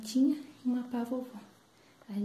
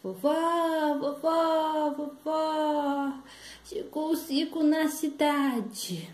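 put-on high, drawn-out child's calls; excited little girl's singsong cry; shouting granddaughter; 5 semitones higher, much slower than usual. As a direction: call out to Grandma with big news. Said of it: "Chegou netinha gritando".

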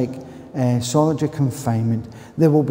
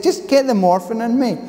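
insistent demand; 10 semitones higher than usual. Retold or imitated. Retold demand to doctor.

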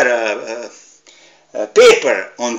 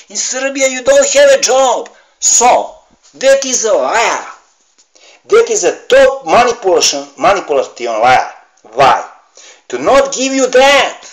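high, loud accusation of liars; angry outburst; 9 semitones higher, 6 dB louder than usual. Real, not acted. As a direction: denounce them at full voice. Angry rant about liars.

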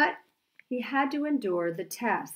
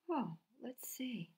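hushed whisper; 3 semitones higher, 12 dB quieter than usual. Acted. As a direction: whisper it in wonder. Peeking into the glowing book.

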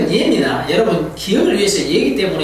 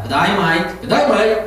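"Good day, mate" with mate as "might", Australian; Australian g'day mate; 2 semitones lower, much slower than usual. Retold or imitated. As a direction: greet like an Aussie, "mate" said "might". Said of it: "mate라지 않고 might랍니다".

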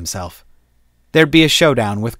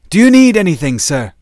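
eager soldier; 7 semitones higher, 7 dB louder than usual. Acted. Soldier to captain.

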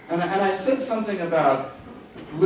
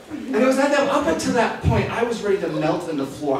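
ordinary talk, nothing marked

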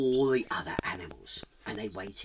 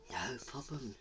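very soft, hushed reply; gentle near-whisper; mountain spirit; 16 dB quieter than usual. Acted. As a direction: answer softly as an unseen spirit.

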